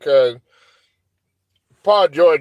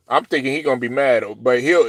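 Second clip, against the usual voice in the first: ordinary delivery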